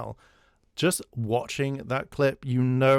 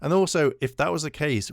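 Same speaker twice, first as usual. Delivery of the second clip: ordinary talk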